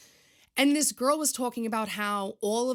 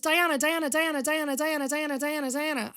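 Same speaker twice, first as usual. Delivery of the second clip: mock calling her name over and over, higher and louder; relatives calling her; 7 semitones higher, 5 dB louder than usual. Relatives at Thanksgiving keep calling Diana for help, louder and higher mock calls. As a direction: play the whole family pestering her from every side.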